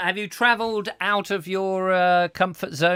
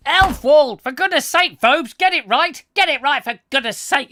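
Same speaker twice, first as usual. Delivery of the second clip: exasperated shout; 8 semitones higher, 7 dB louder than usual. Acted.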